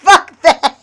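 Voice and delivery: loud, high, laughing defiance; laughing yell of refusal at the cop; laughing shout. Retold or imitated. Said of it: "I'm like".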